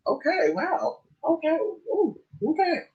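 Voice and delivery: high, soft mock startled voice; retold flustered reaction. Recalling the excited dog jumping on her, mock flustered. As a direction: act out being bowled over, high and small. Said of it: "I was like".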